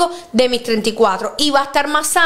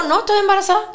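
higher, startled realization; mock panic; 6 semitones higher, faster than usual. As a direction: dread dawning. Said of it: "y yo". (pánico fingido)

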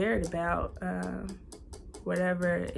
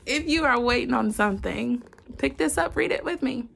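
higher, louder eager urging; louder, higher excited recommendation; 4 semitones higher, 6 dB louder than usual. Real, not acted. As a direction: sell the book to your viewers with real excitement. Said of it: "I'm excited".

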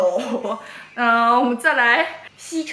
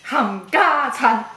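slow exaggerated mouthing; much slower than usual. Mouthing Cantonese for lip reader.

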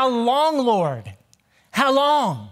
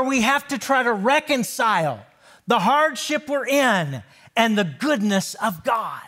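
forceful preaching. Acted. Preacher building to full force.

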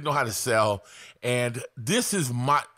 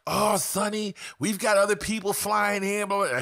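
higher, mocking seller voice; 8 semitones higher than usual. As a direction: mimic her pressure pitch, pitched up. Voicing the pushy seller.

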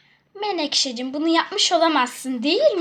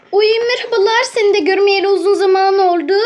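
louder, fast gushing hello; loud excited greeting; 3 semitones higher, 8 dB louder, faster than usual. Pretend. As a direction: gush at the guest you missed. Greets the arriving guest.

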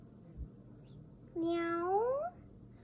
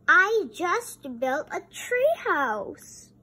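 louder, lively read-aloud voice; bright storytime voice; reading to children; 4 semitones higher, 7 dB louder than usual. Acted. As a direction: read to the kids with big energy.

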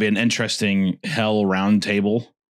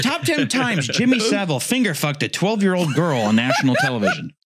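higher-pitched mock countdown announcer; countdown host spoof; 8 semitones higher than usual. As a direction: announce it like a cheery list video.

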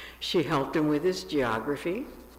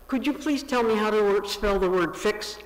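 blurted, higher, louder and fast; bursting out; 7 semitones higher, 6 dB louder than usual. A narrator's reading. Paul blurts his old question to the operator, excited rush. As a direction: let it tumble out on impulse. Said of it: "he suddenly burst out".

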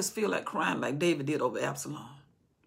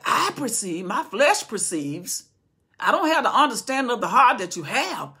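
loud, slow, rising rebuke of the king; a general scolding his king; stern rebuke; 5 semitones higher, 8 dB louder than usual. Acted. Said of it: "Joab said".

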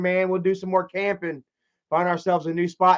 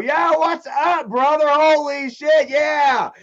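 high, loud, drawn-out hello; excited greeting; 9 semitones higher, 5 dB louder, slower than usual. Real, not acted. Greets old friend arriving in chat.

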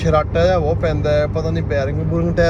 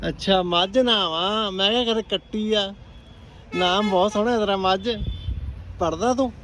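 higher-pitched, kindly questioning; 4 semitones higher, 6 dB quieter than usual. Real, not acted. Driver asks a little girl about her class, child-directed voice. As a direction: draw a little one out with lifted pitch.

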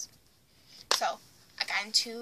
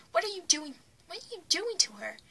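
higher-pitched mock alarm; retold onlooker's protest; alarmed onlookers, imitated; 6 semitones higher, 4 dB louder than usual. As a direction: question it like a worried bystander. Onlookers question sister, alarmed.

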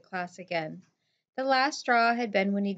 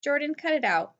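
higher-pitched, uneasy protest; nervous; 7 semitones higher, 3 dB louder than usual. A narrator's reading. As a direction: nervously tell a friend to stop, lightly acted. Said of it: "Lee said nervously".

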